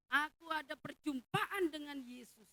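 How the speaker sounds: confident declaration. Acted. Voicing the blind man's faith.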